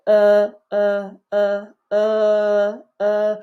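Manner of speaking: "uh" held with neutral lips; loud, isolated neutral "uh"; unrounded central vowel. Shown as a demonstration. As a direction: push out a clear "uh" without rounding. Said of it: "no lip rounding for the central vowels".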